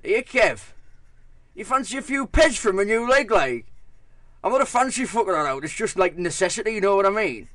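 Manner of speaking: loud, high Teesside dog's voice; Teesside accent. Retold or imitated. Yorkshire Terrier calling the narrator over in a Teesside accent. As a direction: shout it like a cheeky Teesside lad. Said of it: "it said to me in a Teesside accent".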